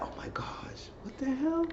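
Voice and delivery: breathless whispered disbelief; shocked whisper. Retold or imitated. Imitating her reaction to the pillow, shocked whisper.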